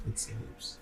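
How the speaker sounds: breathy whisper, barely voiced; whispered repeat. Real, not acted. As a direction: echo it in a hushed whisper.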